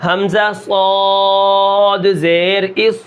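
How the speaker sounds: slow حمزہ صاد زیر اس with full-voiced heavy ص, no bounce. Shown as a demonstration. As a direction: stretch it and thicken the ص. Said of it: "میں نے صاد کا پورا نام لیا". (صاد کو موٹا، آہستہ تلاوت)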